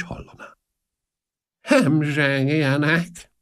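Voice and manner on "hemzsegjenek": high, very slow monotone; listless Marchese. A narrator's reading. Old Marchese ignoring the price question, high listless drawl. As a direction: drag the word out indifferently on one high note. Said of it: "mondta egykedvűen".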